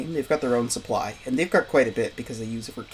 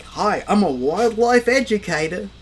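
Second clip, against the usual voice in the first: deliberately bad, slow Australian accent; hammed-up Australian, drawn out and higher; 6 semitones higher, 4 dB louder than usual. Shown as a demonstration. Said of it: "deliberately trying to do a bad Australian accent".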